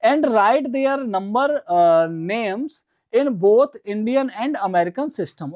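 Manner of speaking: slow, deliberate word by word read; slow reading aloud. Shown as a demonstration. Teacher rereading the question to students, slowly. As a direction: lay each word out slowly for the class.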